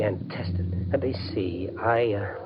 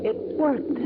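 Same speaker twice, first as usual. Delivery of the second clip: ordinary talk, nothing marked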